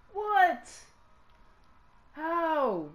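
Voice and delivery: high, loud disbelieving yell; real shocked yell; outraged what. Real, not acted. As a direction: shout in disbelief at the game. Outraged at game call.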